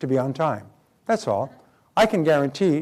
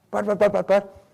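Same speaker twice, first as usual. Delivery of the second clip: mock sputtering; 6 semitones higher than usual.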